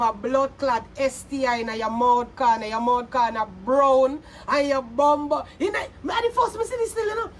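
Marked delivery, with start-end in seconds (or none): 5.6-7.3 fast, high burst of outrage; excited outburst. Real, not acted. Excited mid-rant outburst.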